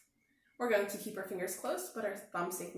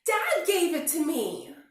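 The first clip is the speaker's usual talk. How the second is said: high, loud, slow plea; distressed; 8 semitones higher, 10 dB louder, slower than usual. Acted. Bear cub telling rabbit the hat was his dad's, high and loud. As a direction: plead it out.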